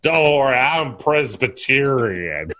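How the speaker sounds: slow tipsy drawl, played for laughs; drinking movie character. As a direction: drag out the last word as if drunk. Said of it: "You should stop drinking so much".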